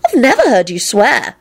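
put-on high, impressed girlish voice; acted admiring amazement; appreciative. A narrator's reading. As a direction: marvel at them, pitch rising. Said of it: "Rosie said appreciatively".

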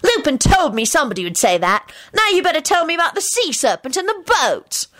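put-on high, triumphant boy; shouted. A narrator's reading. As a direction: crow it at the bully. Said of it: "Timothy shouted".

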